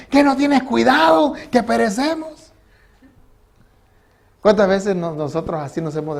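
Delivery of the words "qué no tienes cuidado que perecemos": a retold cry of alarm, higher; frightened shout. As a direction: wake him up in a panic. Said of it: "lo despertaron gritándole".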